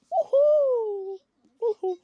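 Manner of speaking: loud, high whoop of joy; excited cheer. Real, not acted. Cheering after finishing the book.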